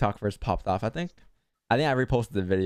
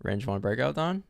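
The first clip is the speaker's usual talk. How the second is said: usual speaking voice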